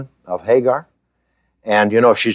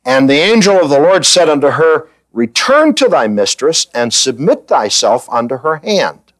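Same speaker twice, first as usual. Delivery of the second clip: scripture reading; 4 semitones higher than usual. Acted. Reads scripture aloud.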